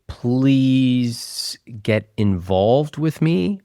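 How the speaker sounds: slow, drawn-out plea; measured, imitated begging. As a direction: stretch out each word of a mock plea. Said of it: "you begging".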